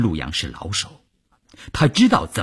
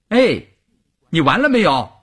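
high, raised voice calling through a door; soldier calling out; 8 semitones higher, 4 dB louder than usual. A narrator's reading. Soldier asks a man behind a shut door, calling out. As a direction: call out a pointed question to someone inside.

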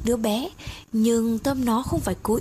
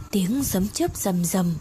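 ordinary delivery

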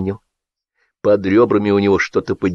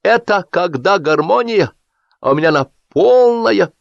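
breaking through cheers; 10 semitones higher, 3 dB louder than usual. A narrator's reading. Drunk Zolotar cutting through the cheering, musing. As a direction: muse loftily over the noise.

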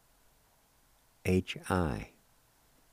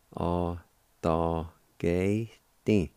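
slow demo; much slower than usual. Shown as a demonstration. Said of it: "like this".

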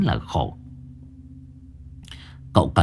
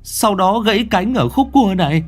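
high youth voice; 10 semitones higher than usual. A narrator's reading. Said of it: "Thằng Quang chỉ tay".

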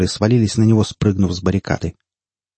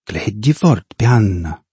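ordinary delivery, nothing marked

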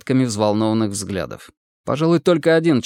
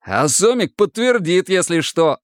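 higher, gladly seizing the idea; pleased exclamation; 7 semitones higher than usual. A narrator's reading. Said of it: "обрадовавшись такому повороту". (обрадованный возглас)

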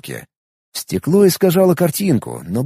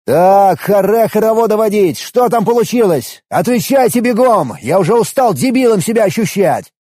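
exasperated yelling; 7 semitones higher, 4 dB louder than usual. Acted. Hero yelling at the celebrating crowd.

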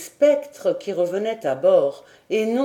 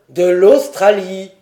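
loud, slow, vehement interruption; vehement cry; 10 dB louder than usual. A narrator's reading. Lord cutting off the old sailor, vehement. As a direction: break in forcefully, drawn out. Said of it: "s'écria Glenarvan avec une véhémence".

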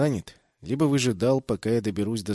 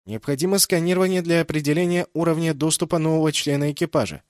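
ship computer voice; 5 semitones higher, 5 dB louder than usual. A narrator's reading. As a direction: report it like a ship's AI. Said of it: "снова вмешалась Шинока". (голос бортового компьютера)

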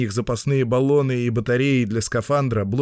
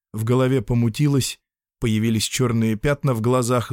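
plain reading voice

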